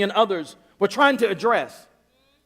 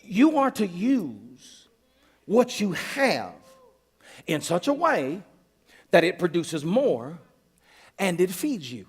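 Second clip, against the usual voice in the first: slow, deliberate; 3 semitones lower, 4 dB quieter, slower than usual. Retold or imitated. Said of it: "He says".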